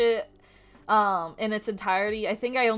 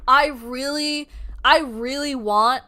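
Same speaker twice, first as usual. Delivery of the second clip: much louder, slow and delighted; excited gush; 9 dB louder than usual. Real, not acted. Gushing to viewers about her favourite Pokemon. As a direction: squeal over the favourite Pokemon.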